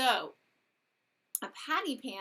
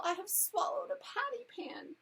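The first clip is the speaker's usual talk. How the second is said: moaning whine; 11 semitones higher than usual. A narrator's reading. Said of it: "Duchess moaned and whined".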